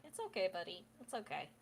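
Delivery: soft, hushed comfort to a pet; gently calm him down; soothing hush. Acted. Octavia petting the sulking horse's snout, hushed. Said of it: "pets his snout, like".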